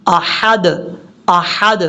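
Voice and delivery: احد with a breathy Arabic ha, the final د stopped short; correct Arabic ha. Shown as a demonstration. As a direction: say احد slowly with a clean breathed ha. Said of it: "اس طریقے سے".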